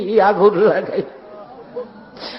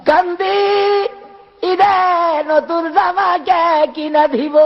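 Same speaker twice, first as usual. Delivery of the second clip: orphan's lament; 9 semitones higher than usual. Retold or imitated. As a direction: plead like a crying orphan. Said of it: "এতিম হয়ে গেছি".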